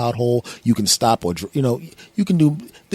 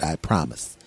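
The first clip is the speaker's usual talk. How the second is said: low, soft second voice; low alter-ego answering himself; different voice, promising; 7 semitones lower, 7 dB quieter, slower than usual. Acted. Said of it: "using a different voice".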